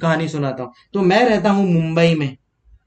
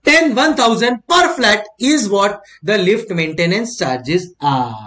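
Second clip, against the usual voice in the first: very slow, each figure laid out; slow explaining; much slower than usual. Shown as a demonstration. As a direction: spell out the result for students. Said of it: "teacher stating result".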